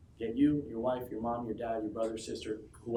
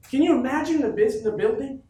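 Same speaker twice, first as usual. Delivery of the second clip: louder and much higher with wonder; excited; 10 semitones higher, 7 dB louder than usual. Real, not acted. Speaker to his team, marvelling loudly at the office. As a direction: marvel out loud at it. Hyping crowd about office.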